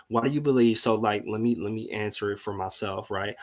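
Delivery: ordinary talk, nothing marked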